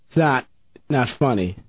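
ordinary talk, nothing marked

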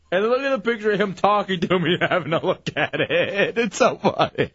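words dissolving into laughter; laughing. Real, not acted. Host cracks up over a picture, laughing. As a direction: crack up helplessly while talking.

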